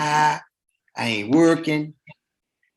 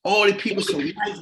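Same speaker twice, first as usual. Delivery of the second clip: normal talking voice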